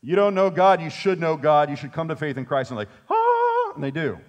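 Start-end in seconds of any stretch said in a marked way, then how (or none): 0.0-2.7 loud, eager street-preacher call; hammed-up pushy witnessing, louder; mock preaching. Eager believer preaching at the neighbor, loud and pushy. 3.1-3.7 high, loud mock gasp of revelation; a sudden bright cry of realization; surprised gasp. Converted neighbor's sudden high gasp of revelation.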